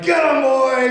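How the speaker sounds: high, loud cheer to a dog; rousing mock dog-owner shout; urging yell. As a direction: egg the dog on loudly. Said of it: "A good place to amen is like".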